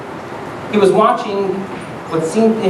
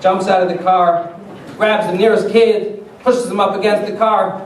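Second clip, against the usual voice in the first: ordinary talk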